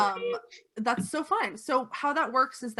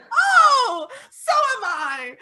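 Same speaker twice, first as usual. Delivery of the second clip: much higher, louder granny fussing; doting old lady; 14 semitones higher, 11 dB louder, much slower than usual. Acted. Old lady fusses over the vampire who broke her glass. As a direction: coo over the guest.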